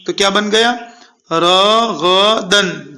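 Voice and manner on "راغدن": syllables drawn out one by one, very slow; slow spelled-out reading. Shown as a demonstration. Quran teacher to pupils, slow careful drill. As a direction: read راغدن slowly, syllable by syllable. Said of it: "جلدی پڑھیے".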